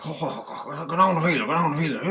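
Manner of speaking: high, loud, garbled Irish country accent. Shown as a demonstration. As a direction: imitate a barely intelligible rural Irish accent, rattled off. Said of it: "like a country accent".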